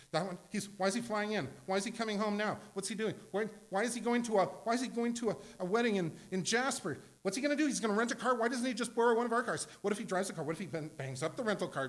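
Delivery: high, loud, fast anxious fretting; panicky worrying. Retold or imitated. Parent fretting over son flying home for a wedding, high loud panic. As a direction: spiral into worst cases. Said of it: "We're freaking out all the time".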